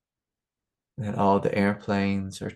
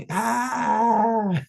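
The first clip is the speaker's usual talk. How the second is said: high shrieking scream; death cry; 4 dB louder than usual. Retold or imitated. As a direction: let out a piercing howl. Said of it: "I'll just let out, like, this death scream".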